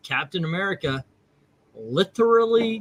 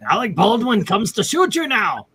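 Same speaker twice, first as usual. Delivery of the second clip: higher-pitched mock German accent; 6 semitones higher, 4 dB louder than usual. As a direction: put on a hammed-up German accent. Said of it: "Anybody know a German accent?".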